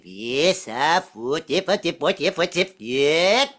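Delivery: put-on a lightly done Swedish accent, sing-song; mock Swedish accent. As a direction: fake Swedish accent, talking gibberish. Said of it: "talk with a Swedish accent".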